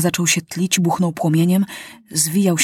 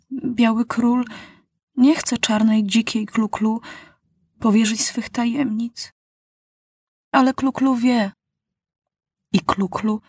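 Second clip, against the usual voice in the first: put-on higher, solemn devoted girl; Klu-Klu voice; 3 semitones higher than usual. A narrator's reading. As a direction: vow it like a faithful servant. Klu-Klu speaks to the king.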